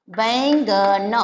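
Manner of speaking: "बैंगन" stretched very slow, every sound drawn out; slow drawn-out word. Shown as a demonstration. Teacher showing children the word, drawn out slowly. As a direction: draw out बैंगन slowly for the class. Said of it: "ब पे ऐ की मात्रा बैगन".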